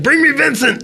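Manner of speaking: high, barked order; commanding shout. Acted. Lord shouting an order down the hall at a rat. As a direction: demand it at the top of the voice. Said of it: "And he's like".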